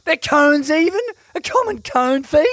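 mock-furious accusation; mock outrage. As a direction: pretend to be scandalised at the co-host. Mock outraged host roasting co-host.

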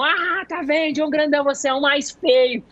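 high, gleeful teasing of the big partner; mock triumphant clown. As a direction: crow it like a clown who won. Palhaço zoando o parceiro grandão. (palhaço zoando o parceiro, voz alta e exultante)

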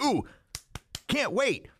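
high, breathy ooh of fake eagerness; mock excitement. Shopper gushing over the new iPhone, mock excited. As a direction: play the hyped shopper.

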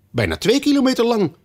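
high, sharp queen's jab. Acted. Queen adds to the king against Alice, high and sharp. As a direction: pile it on for the queen.

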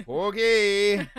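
high, loud Minnesota "Okay"; drawn-out Minnesota accent. Retold or imitated. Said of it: "Got all Minnesota on us".

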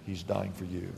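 soft, dropped low repeat of He's dying for you; quiet. Acted. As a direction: let He's dying for you fall to a hush. Repeated line, softer second take.

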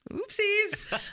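high, laughing squeal of oopsies. Real, not acted.

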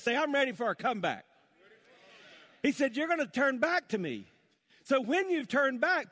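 high, loud, rousing proclamation; rally the crowd for a comeback; fired-up preaching. Acted. Preacher promising the church a comeback, fired-up preaching.

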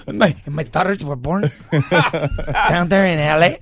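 slow attempt at dad's accent, laughing; badly faked dad accent breaking into laughter; imitating dad. Son failing at dad narrating the book, laughing imitation. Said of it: "I can't even do my".

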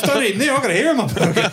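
very high, laughing outrage; agitated bald stranger. Retold or imitated. Bald man warning people off the shop, high laughing voice. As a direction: squeal the warning through laughter. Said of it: "He goes".

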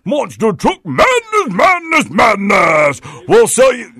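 loud, high shouting arena ad; monster truck ad. Retold or imitated. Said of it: "I like when they go".

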